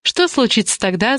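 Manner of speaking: ordinary delivery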